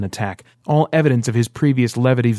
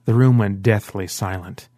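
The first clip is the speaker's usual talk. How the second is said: ordinary delivery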